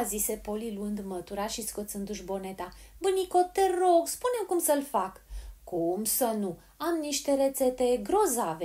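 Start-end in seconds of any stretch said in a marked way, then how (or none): 3.0-5.2 put-on high, raised girl's voice; eager, high plea; loud pleading. A narrator's reading. Polly calls pleading to deaf granny. 5.6-8.6 put-on slow, relishing old woman; stretched, pleased exclaiming; delighted exclamation. A narrator's reading. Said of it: "exclamă bătrâna, încântată".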